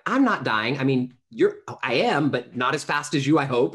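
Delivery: flustered, laughing it off in shock; flustered disbelief. Retold or imitated. Retold own shocked reply.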